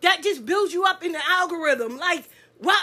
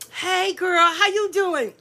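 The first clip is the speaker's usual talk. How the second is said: put-on lightly sugary "Hey, girl" to her face; two-faced friend greeting sweetly; a hint of syrupy fake friend; 5 semitones higher, slower than usual. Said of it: "I seen more motherfucking Benita".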